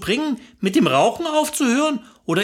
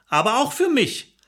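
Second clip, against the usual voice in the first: usual speaking voice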